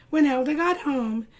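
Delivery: ordinary delivery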